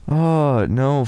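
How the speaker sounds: usual speaking voice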